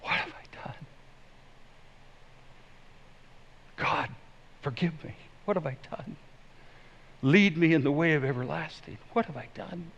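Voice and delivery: hushed plea, starting in a whisper; repentant prayer. Acted. Repentant sinner asks God for forgiveness, hushed plea. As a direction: whisper it brokenly soft to God, then a little voice. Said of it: "Repentance says".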